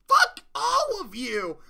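enraged parting yell; furious outburst. Retold or imitated. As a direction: spit it at all of them. Villain storming off in rage.

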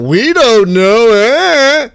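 loud, slow, drawn-out dopey mimicry; exaggerated slow singsong mockery; mock clueless answer.

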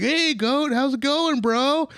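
high, laid-back surfer bro; surfer dude voice. Retold or imitated. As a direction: greet him like a chill surfer. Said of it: "They're like".

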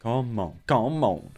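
ordinary talk, nothing marked

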